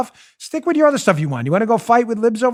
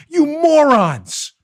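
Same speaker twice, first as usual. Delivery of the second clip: scolding; 7 semitones higher, much slower than usual. Real, not acted. Scolding celebrities.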